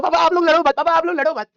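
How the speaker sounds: high, loud wailing plea; mock crying, begging the parents; imitating crying child. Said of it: "और मैं रो रहा हूं". (रोते हुए बच्चे की नकल, ज़ोर से विनती)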